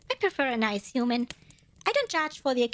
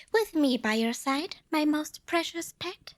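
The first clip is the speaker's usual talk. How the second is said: ordinary delivery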